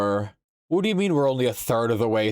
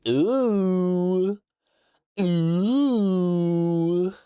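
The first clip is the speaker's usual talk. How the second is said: high, delighted ooh; long, high thrilled coo; excited ooh; 10 semitones higher, 3 dB louder than usual. Real, not acted. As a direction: squeal with glee at the spoiler. Excited at spoiler.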